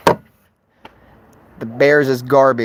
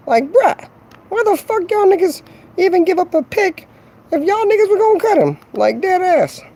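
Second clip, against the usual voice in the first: high-pitched outraged voice. Acted. Outraged rant about the cut.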